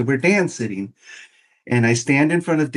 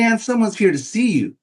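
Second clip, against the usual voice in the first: higher, lightly smirking front-desk lady; receptionist voice; 9 semitones higher than usual. Retold or imitated. Receptionist telling her boss Dan a visitor came, higher and amused.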